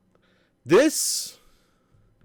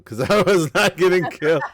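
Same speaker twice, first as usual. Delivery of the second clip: higher, louder, through a laugh; laughing while talking; 6 semitones higher, 6 dB louder than usual. Real, not acted. Player recounts an unbeatable streak to co-host, laughing.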